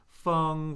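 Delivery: "Feng" held high and flat; first tone. Shown as a demonstration. Teacher cycling four tones on a syllable, "Feng" high and flat. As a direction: say "Feng" on a high, level pitch. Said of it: "Let's do the four tones".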